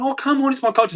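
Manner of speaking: high sneering mock voice; mocking whine. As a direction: sneer it like a pleading excuse. Mocking the attacker's excuse.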